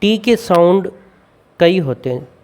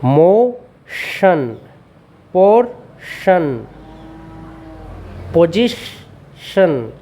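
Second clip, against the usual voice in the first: drawn-out teacher demo of each word; very slow and clear, like a phonics model; slow model reading; 3 semitones higher, much slower than usual. Shown as a demonstration. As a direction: stretch every syllable so pupils can copy. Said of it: "तो इसको कैसे पढ़ेंगे हम?".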